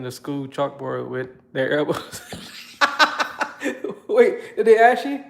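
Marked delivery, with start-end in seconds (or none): 2.2-4.0 real high, breathy burst of laughter; helpless laughter; laughing. Real, not acted. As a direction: crack up at being teased. Laughs at a teasing comment.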